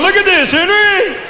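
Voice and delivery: high, slow excited yell; excited shout. Retold or imitated. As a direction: shout it high with giddy pride. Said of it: "He was going".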